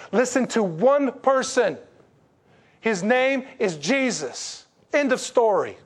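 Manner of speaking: building sermon climax, slow and ringing; preaching at full force. Acted. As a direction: hammer each claim home to the congregation.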